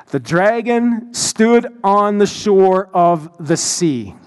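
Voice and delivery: deliberate, very slow reading aloud; measured, weighty Bible reading; slow scripture reading. Real, not acted. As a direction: read the verse out slowly, word by word. Reads scripture verse aloud to congregation.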